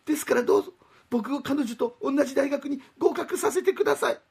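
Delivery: put-on high, earnest boyish plea; pleading young man. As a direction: beg like a lovesick student.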